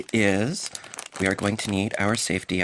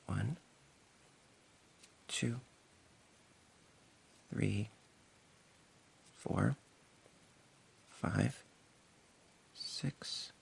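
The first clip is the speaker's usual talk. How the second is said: soft counting, each number much softer; counting under breath; 10 dB quieter, much slower than usual. Real, not acted. Crocheter counting stitches softly to herself. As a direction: murmur the count to yourself, slow.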